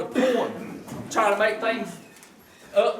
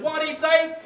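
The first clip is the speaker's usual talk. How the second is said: higher, louder mock deaf wife; hard-of-hearing wife asks husband, louder higher mock question; 9 semitones higher, 3 dB louder than usual.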